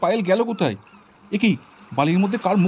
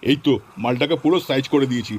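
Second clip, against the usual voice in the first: gloating villain; 7 semitones lower than usual. Acted. Villain gloats while burying her.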